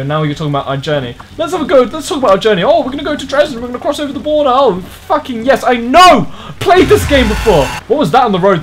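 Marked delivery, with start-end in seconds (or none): 1.4-8.6 high, loud sarcastic tirade; worked-up, high-pitched mocking outburst; mock exasperated rant. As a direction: rant back, building to a shout. Ranting back at talking car.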